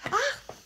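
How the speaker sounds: high, loud "ah!" of sudden remembering; sudden exclamation. Real, not acted. Vlogger remembers she forgot something, sudden exclamation. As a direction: cry out as something comes back to you.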